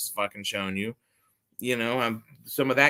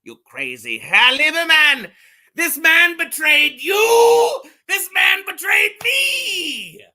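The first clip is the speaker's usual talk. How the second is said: high, loud, slow film quote with a German name; over-the-top movie villain-hunter shout; Gregory Peck impression; 10 dB louder, slower than usual. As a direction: ham up Gregory Peck's dramatic line. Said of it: "That was Gregory Peck".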